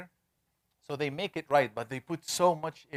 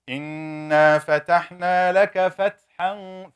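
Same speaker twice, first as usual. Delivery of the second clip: slow, louder, chanted recitation; Quran recitation; 4 semitones higher, 7 dB louder, much slower than usual. Shown as a demonstration. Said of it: "You don't say fathan".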